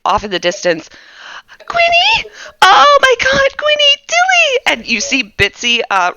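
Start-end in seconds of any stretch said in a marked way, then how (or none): 1.7-4.6 high, loud panicked cries for her pets; frantic calling out. Acted. Bitsy running toward the party, shrieking in panic. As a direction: shriek their names in panic.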